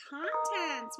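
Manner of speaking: ordinary talk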